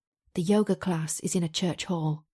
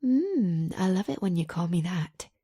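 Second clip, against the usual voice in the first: ordinary delivery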